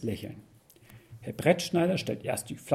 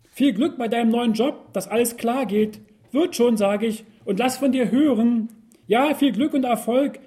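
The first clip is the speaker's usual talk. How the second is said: tipsy cheerful goodbye; 7 semitones higher, 6 dB louder than usual. Acted.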